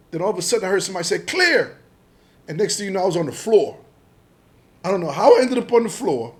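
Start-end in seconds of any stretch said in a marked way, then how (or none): 1.2-1.8 very high, loud mock raid call; shouted call. Agent signalling during the arrest, retold loud shout. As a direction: imitate an officer calling out.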